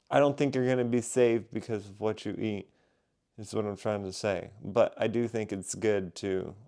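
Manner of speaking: ordinary talk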